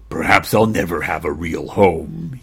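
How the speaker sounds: low, forlorn puppy voice; sad puppy. Acted. Unwanted puppy thinking to himself, low and forlorn. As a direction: let the hope sink out of it. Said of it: "He felt sadder and sadder".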